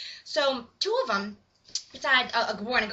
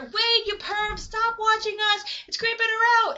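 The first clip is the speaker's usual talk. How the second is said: higher, drawn-out calling out to a friend; scolding call; 8 semitones higher than usual. Retold or imitated. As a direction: call out a mock reproach.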